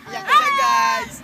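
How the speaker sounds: high, loud, drawn-out taunt; loud singsong tease; teasing call-out. Real, not acted. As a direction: slowly crow it to the viewers. Teasing friend who cries on ride.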